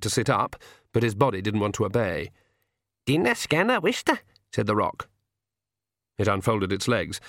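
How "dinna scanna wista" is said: high-pitched pixie dialect; pixie woman. A narrator's reading. Pixie woman addresses the captive king, high dialect voice.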